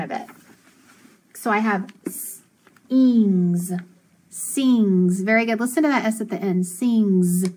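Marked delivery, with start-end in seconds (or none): 2.1-5.2 "s-", pause, "ings", then blended "sings"; sounded out. Shown as a demonstration. Teacher blending sounds for pupils, sounded out. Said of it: "I is always gonna be what?". 6.7-7.4 "Sings" drawn out with buzzing z ending; final Z sound. Shown as a demonstration. Teacher showing the S at the end, final Z sound. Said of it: "It makes that Z sound".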